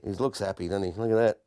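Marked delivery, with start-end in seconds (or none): none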